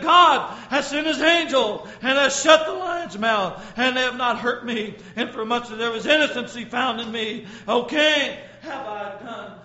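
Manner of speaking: loud, rousing sermon delivery; full-force preaching. Acted. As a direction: thunder the scripture out to the church.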